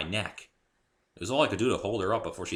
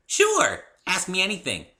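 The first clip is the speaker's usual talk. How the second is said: high, loud bouncy pony voice; bubbly pony; 11 semitones higher, 8 dB louder than usual. A narrator's reading. Pinkie explaining Spike to the newcomer, high and bubbly. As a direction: chirp it like a cheerful pony.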